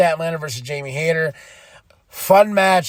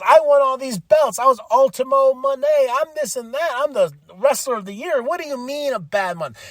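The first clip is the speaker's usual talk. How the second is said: higher-pitched bragging diva; a touch of a boastful champion; mock diva boast; 9 semitones higher than usual. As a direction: list your titles, affronted. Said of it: "she goes".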